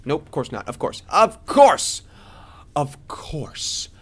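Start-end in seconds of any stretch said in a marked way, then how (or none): 1.1-2.0 high, loud exasperation; exasperated outburst. Real, not acted. Gamer finding nothing cooking, exasperated outburst. 2.8-3.9 whispered, let-down afterthought; resigned whisper. Real, not acted. Gamer finding nothing cooking, resigned whisper. Same words, dropped to whisper.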